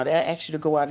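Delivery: usual speaking voice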